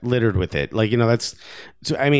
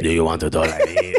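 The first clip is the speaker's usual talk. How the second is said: mock French accent dropped lower; deeper, comic accented voice; 4 semitones lower than usual. Shown as a demonstration. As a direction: drop the put-on accent deep, half laughing. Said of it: "I don't know why I just went deeper".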